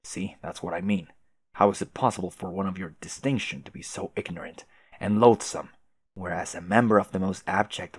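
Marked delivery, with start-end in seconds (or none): none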